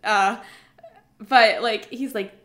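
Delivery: ordinary delivery, nothing marked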